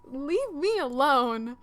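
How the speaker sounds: loud, drawn-out hammed-up protest; play-yell it, stretching each word out; mock angry yell. Game player voicing Tiana fed up with Beast, mock yelling. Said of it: "just yelled at Ti-, ... she's like".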